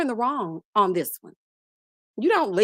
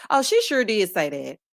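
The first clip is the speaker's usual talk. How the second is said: ordinary delivery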